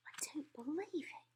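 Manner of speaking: whispered in shock; murmured disbelief. A narrator's reading. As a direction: breathe it out, stunned. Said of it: "the Queen was murmuring".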